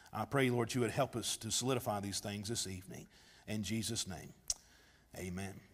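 bow your head and pray quietly; prayer. Real, not acted. Pastor praying over congregation before sermon, hushed and soft.

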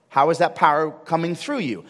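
ordinary talk, nothing marked

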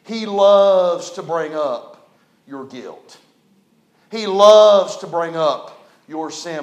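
slow preaching emphasis. Acted. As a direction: lean on every word.

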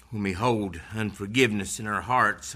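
ordinary talk, nothing marked

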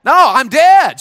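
high, loud rallying shout; loud call for the crowd to echo. Shown as a demonstration. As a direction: shout it to get them going. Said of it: "Say it".

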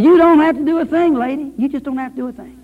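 loud, high pulpit exhortation; fervent preaching. Acted. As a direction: press it home to the congregation. Preacher rising in fervor.

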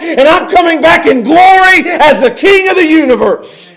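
high, loud, fervent promise in a preacher's voice; Jesus rallying disciples. Acted. Preacher as Jesus promising his disciples a return, fervently building. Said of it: "he looks at his disciples and he says".

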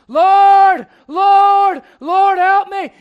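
high, loud crying out for help; desperate shout. Acted. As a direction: call out over the crowd, begging. Blind man crying out to Jesus.

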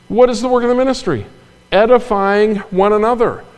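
high, fast rhetorical question; preacher pitching up to grab the room; excited preaching. Real, not acted. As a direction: lift the question up high and urgent.

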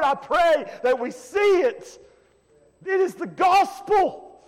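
high, loud, rhythmic preaching; preaching at full force. Real, not acted. Preacher proclaiming the changed life to his church, loud and fervent. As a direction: drive each line home with fervor.

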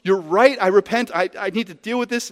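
mock remorse; tearful repentance. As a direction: play Bob caving in, lightly voiced. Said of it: "Bob is gonna weep and repent".

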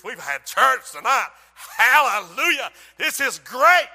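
loud, drawn-out hallelujah shout; big mock-revival elation; acted jubilant shouting. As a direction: mimic an exuberant churchgoer celebrating.

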